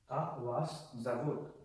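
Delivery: "Как вас зовут" slowly, word by word; slow recap demonstration. Shown as a demonstration. Teacher recapping how to ask what is your name, slowly word by word. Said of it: "So to recap".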